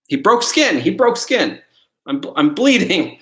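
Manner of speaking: high, loud startled cry at the cat bite; real shock of a cat bite; startled yelp. Real, not acted. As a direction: yelp it out, just got bitten. Cat just bit him.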